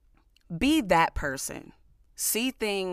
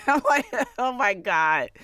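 laughing; 6 semitones higher, 5 dB louder than usual. Real, not acted. Laughing at a joke.

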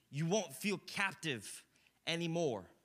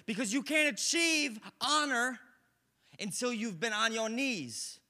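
full-force preaching; 7 semitones higher, 6 dB louder than usual. Acted. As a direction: drive the scripture home from the pulpit.

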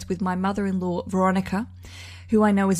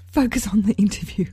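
playful conspiratorial whisper; whispered aside. Pretend. Host nudging listeners past the background noise, whispered aside.

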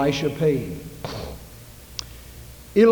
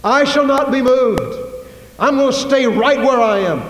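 loud, higher hammed-up defiance; stubborn mock shout; 7 semitones higher, 4 dB louder than usual. Preacher mocks a stubborn churchgoer with a loud, defiant vow. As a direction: belt it like a churchgoer who won't budge.

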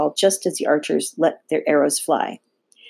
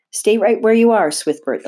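high, forceful start dropping on the name; commanding, raised storybook voice; stern order; 6 semitones higher, 5 dB louder than usual. A narrator's reading. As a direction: order them to stay put. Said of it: "Olympia ordered".

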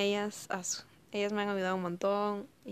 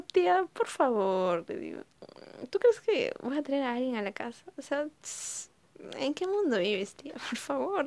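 hija exasperada; 6 semitones higher than usual. Retold or imitated.

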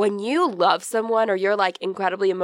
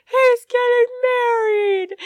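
much higher, slow, crying wail; imitating Sally sobbing on the phone; mock sobbing; 13 semitones higher, 4 dB louder, slower than usual. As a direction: wail it like the news just broke you. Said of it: "she's like".

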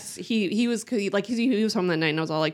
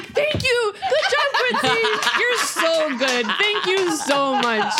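very high, mock-gushing; hammed-up squeal of congratulation; exaggerated praise; 7 semitones higher, slower than usual. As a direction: gush at a toddler through laughter. Acted over-praise after forcing toddler's hand.

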